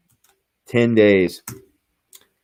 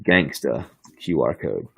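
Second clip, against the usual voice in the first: dramatic reveal; 7 semitones lower, 3 dB quieter than usual. Real, not acted.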